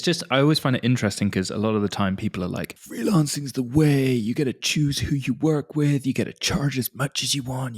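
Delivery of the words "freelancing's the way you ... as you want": put-on American accent. Host mimics freelancer advice in a put-on American accent. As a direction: ham up an American accent, mocking freelancer hype. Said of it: "I don't know why I did that accent".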